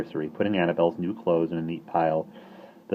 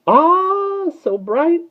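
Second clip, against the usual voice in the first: awed starling; 10 dB louder, much slower than usual. Acted.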